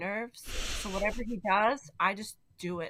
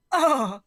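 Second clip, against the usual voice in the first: pained groan; 4 semitones higher, 13 dB louder than usual. Retold or imitated. Peter Griffin agony groan.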